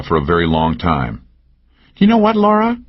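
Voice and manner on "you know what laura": higher, teasing woman's voice; Cal's voice. A narrator's reading. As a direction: needle him with a pitched-up lilt. Narrator voices Cal.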